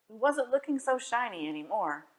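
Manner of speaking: plain reading voice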